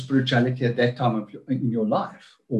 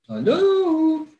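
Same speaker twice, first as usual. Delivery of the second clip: bad connection. Real, not acted. Video call freezes, calling out.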